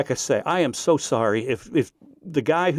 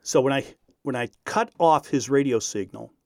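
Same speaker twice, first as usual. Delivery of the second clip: ordinary talk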